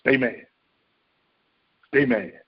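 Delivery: low, coaxing voice urging him to stay mad; voice of the senses. Acted. Five senses whispering to the listener, low coaxing tempter. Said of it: "That's your five senses telling you".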